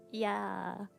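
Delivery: ordinary talk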